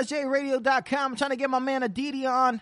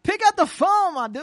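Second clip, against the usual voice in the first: higher, louder demand; loud impatient call; 7 semitones higher, 5 dB louder than usual. Real, not acted. Host begging a caller on air, loud impatient. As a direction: urge him to pick up.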